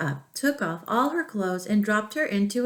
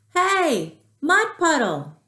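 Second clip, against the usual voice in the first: much higher and louder shout; yelling louder; 9 semitones higher, 6 dB louder, slower than usual. A narrator's reading. Girl shouting louder at the hidden mud puddle. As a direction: shout it across the yard. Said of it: "So she yelled even louder".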